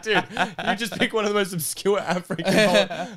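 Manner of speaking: real laughter bursting through the words; laughing while talking. Real, not acted. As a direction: crack up mid-sentence. Laughing at the story.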